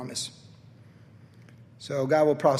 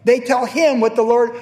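ordinary delivery

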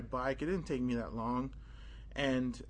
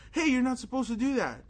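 raised, higher scolding retort; indignant protest; 10 semitones higher, 5 dB louder than usual. Retold or imitated. Boy repeating his complaint to the priest, raised and high. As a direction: tell him off, voice up.

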